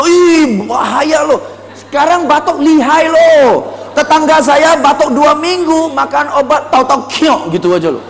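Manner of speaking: high, loud scaremongering; hammed-up dire warning; exaggerated alarm. Shown as a demonstration. Salesperson warning a coughing customer about the danger, hammed-up alarm.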